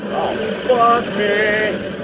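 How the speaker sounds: slow, drawn-out yelp of fright; panicked curse. Real, not acted. Cornered gamer cries out in real panic. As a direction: cry out as the monster gets close.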